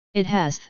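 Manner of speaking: "has" lisped, the s turned to th; tongue-damaged lisp on "has"; lisping exclamation. A narrator's reading. Jaraz protesting about his healed tongue, lisping "has". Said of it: "Jaraz lisped".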